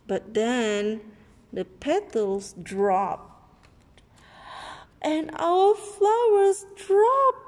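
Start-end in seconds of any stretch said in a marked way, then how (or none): 5.0-7.4 high, loud, slow wail; dramatic. Acted. Seed recalls the flowers dropping, high slow wail. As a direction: make the fall sound like doom.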